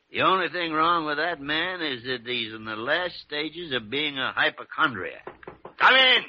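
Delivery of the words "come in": loud, high call through the door. Acted. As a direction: call the visitor in. Calling through the closed door.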